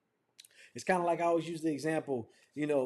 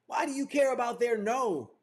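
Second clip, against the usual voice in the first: ordinary delivery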